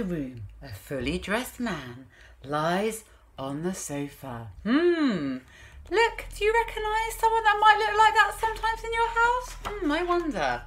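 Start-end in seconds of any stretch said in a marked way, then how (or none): none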